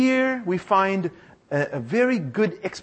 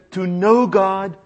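slow, firm, every word weighted; slow emphatic motto; 4 dB louder, much slower than usual. Shown as a demonstration. Preacher teaching the congregation a motto, slow and firm. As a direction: spell it out slowly to be memorized. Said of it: "You wanna say that with me?".

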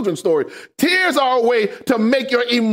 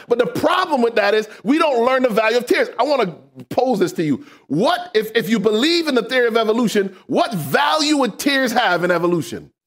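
ordinary delivery

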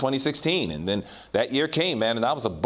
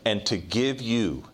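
slow scripture reading; 5 semitones lower, much slower than usual. Real, not acted. Reads scripture slowly, softly.

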